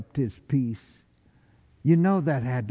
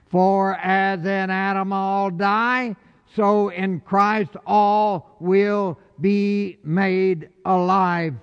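scripture reading; 5 semitones higher than usual. Acted.

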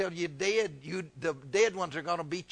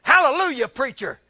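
high, loud burst, dropping on the last word; imitating excited churchgoer; 9 semitones higher, 11 dB louder than usual. Lady interrupting again, mimicked excited praise. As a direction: call it out as the overexcited lady. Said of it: "she got all excited again".

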